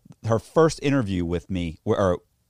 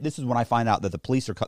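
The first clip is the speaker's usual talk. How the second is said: ordinary talk, nothing marked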